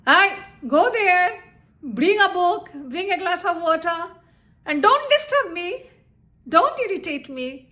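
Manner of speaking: irritated rapid-fire orders, pitched high. Shown as a demonstration. Teacher demonstrating rising intonation, irritated bossy orders. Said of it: "See, my voice has already risen".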